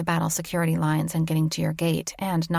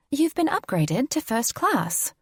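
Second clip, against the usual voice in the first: high, slow, polished British announcement; prim British accent; 8 semitones higher, slower than usual. Acted. Flight attendant telling the passenger of her upgrade in a prim British accent. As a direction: deliver it like a courteous English stewardess. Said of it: "in her prim British accent".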